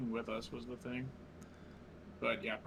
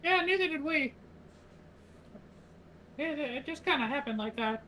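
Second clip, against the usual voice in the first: much higher squeaky little-guy voice; gravelly sly hobgoblin. Acted. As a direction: chirp it like a tiny creature. Plays his character in high voice.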